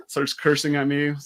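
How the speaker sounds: usual speaking voice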